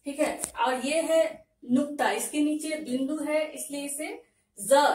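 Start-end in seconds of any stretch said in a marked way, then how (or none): 4.6-4.9 ज with the dot said as za, buzzing; za sound. Shown as a demonstration. Teacher demonstrates nukta ज as za. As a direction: buzz the ज as za, like English Z. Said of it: "इसका उच्चारण करेंगे ज".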